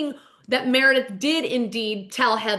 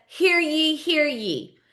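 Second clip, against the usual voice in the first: higher, sing-out mock proclamation; town crier; 5 semitones higher, much slower than usual. [name] calls court into session, proclaiming like a crier. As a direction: call court to order, first call high. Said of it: "calls court into session".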